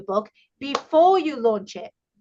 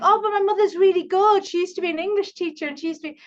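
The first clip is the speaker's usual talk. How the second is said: higher-pitched mock client protest; imitated protesting client; 7 semitones higher, faster than usual. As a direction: plead mother's case as an imitated client. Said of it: "And they're like".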